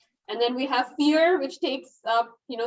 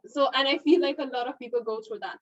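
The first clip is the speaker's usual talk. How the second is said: normal talking voice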